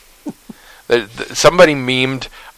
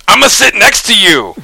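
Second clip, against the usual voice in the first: higher, louder goofy outburst; all-caps shout; 10 semitones higher, 5 dB louder than usual. Acted. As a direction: blurt it out in eager all caps. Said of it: "Biden, in all caps".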